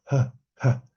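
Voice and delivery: two short exhaled "ha" sounds; little knocks of air, one then another; puffs of aspirated H. Shown as a demonstration. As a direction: demonstrate the h as pure breath. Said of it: "Ese sonido".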